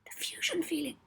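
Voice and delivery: high, soft gasp of wonder; a hushed, high gasp. Acted. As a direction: breathe it out in amazement. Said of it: "Molly gasped".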